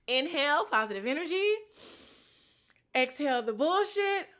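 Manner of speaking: mock meditation sign-off. Sign-off ritual.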